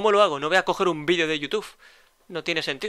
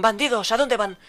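swordsmen shouting at the bandits, raised voice; shouted challenge; 4 semitones higher, 3 dB louder than usual. Acted. Swordsmen shouting at fleeing bandits, raised voice. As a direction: call out sharply to stop them. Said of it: "y gritaron".

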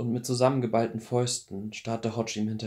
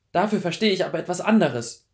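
high, loud, bitter answer; bitter retort; 7 semitones higher, 7 dB louder than usual. Acted. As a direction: spit it back at him. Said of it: "Die Bitterkeit in ihrer Stimme".

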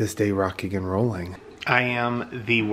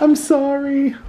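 much higher, louder pretend puppy voice; pretend dog voice; 4 dB louder than usual. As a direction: speak for the dog as a sheepish pup, pitched way up. Owner voices dog apologizing.

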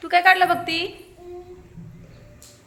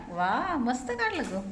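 soft slow praise; 3 semitones lower, 10 dB quieter, slower than usual. Real, not acted. Teacher praising a child's drawing.

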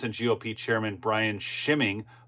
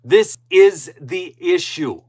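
louder, slow and higher, every word hammered; fervent, pounding statement of the point; emphatic declaration; 6 semitones higher, 8 dB louder, slower than usual. Real, not acted. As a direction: drive it home word by word. Ranting about election integrity.

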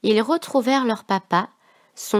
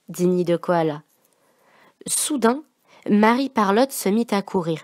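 ordinary delivery

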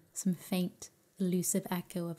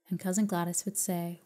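ordinary delivery

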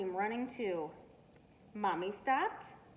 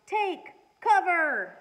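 slow, much louder, higher warning; alarm shout; 8 semitones higher, 12 dB louder, much slower than usual. A narrator's reading. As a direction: bellow the warning. Cassie warning of stampede.

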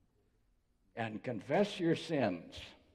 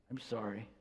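low, muted, reluctant apology; 4 semitones lower, 6 dB quieter than usual. Shown as a demonstration. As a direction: force out an apology with difficulty, softly. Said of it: "comes out with difficulty sometimes".

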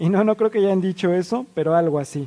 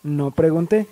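usual speaking voice

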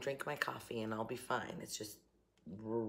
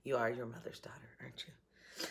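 a fond, conspiratorial whisper; whispered aside; 2 semitones lower, 5 dB quieter than usual. Real, not acted. Mother whispers a fond aside about her daughter. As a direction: whisper it, half to herself.

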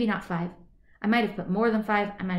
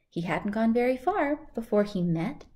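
ordinary delivery

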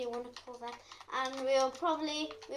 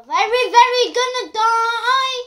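loud, slow panicked wail; 7 semitones higher, 14 dB louder than usual. Real, not acted. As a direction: drag it out in panic. Panicking at mobs.

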